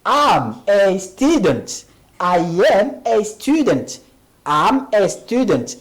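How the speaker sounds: très lentement, mot par mot; détache chaque syllabe pour les élèves; lent et articulé. Shown as a demonstration. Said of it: "on dit". (prononciation lente de professeur)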